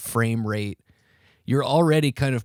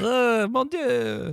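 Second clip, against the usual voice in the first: very high, slow French gasp; French accent; 12 semitones higher, much slower than usual. Retold or imitated. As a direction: squeal it like a shocked Frenchman. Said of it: "The French guys are like".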